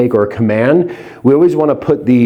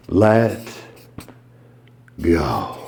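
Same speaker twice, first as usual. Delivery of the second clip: hypnotic let go; 7 semitones lower, 6 dB quieter than usual. Shown as a demonstration. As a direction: lower and softer, released slowly. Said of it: "Number six".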